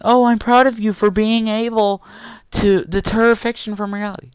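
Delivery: high, sing-song fake praise; mocking praise. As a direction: parody a patronizing pat on the back. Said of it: "by saying".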